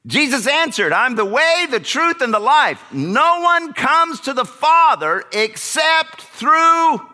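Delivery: high, loud preaching voice. Acted. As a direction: proclaim it from the pulpit. Preaching scripture at full force.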